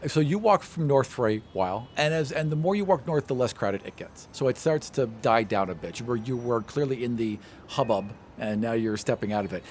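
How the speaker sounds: ordinary talk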